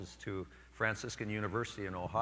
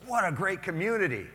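high, loud admiring; impressed lady; 9 semitones higher, 6 dB louder than usual. Retold or imitated. Newcomer lady at coffee praising the parish, high. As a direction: gush like a delighted newcomer. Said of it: "and she goes".

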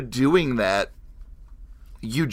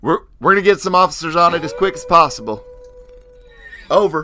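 higher, louder, fast radio reply; radio dispatcher; 5 semitones higher, 7 dB louder, faster than usual. Acted.

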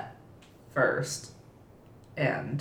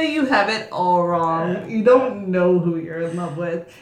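ordinary delivery

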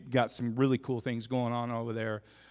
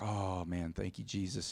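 relieved under breath; 7 semitones lower, 10 dB quieter than usual. Retold or imitated. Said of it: "And I'm like".

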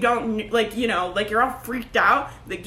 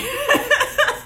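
high burst of laughter; 13 semitones higher than usual. Real, not acted. Laughs at her own point.

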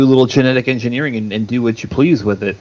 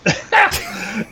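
high laugh. Real, not acted. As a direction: burst of high-pitched laughter. Laughs at joke.

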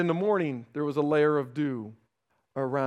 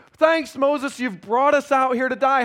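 complaining; 6 semitones higher, 5 dB louder than usual. Acted. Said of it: "what are they saying?".

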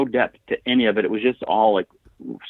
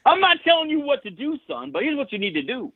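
much higher, put-on bro voice; Sammy-style advice. Cohost joining the Sammy bit, much higher put-on voice. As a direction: boss someone around while claiming not to.

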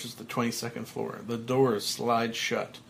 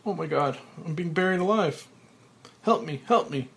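usual speaking voice

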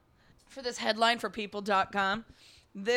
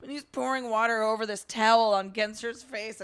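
ordinary delivery

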